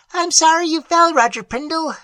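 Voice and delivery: high, tiny shrill troll voice; shrill tinkling voice. A narrator's reading. Tiny troll apologizing to Prindle, high and shrill. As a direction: squeak it out like a tiny fairy. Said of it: "shrilled the tinkling voice".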